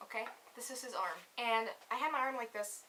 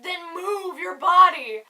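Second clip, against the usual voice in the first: loud, drawn-out, higher-pitched retort; mock exasperated yell; 5 semitones higher, 15 dB louder, slower than usual. Date answering her complaint about breathing, loud mock yell. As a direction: belt it out slowly as a mock order.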